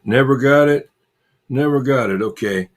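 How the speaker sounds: usual speaking voice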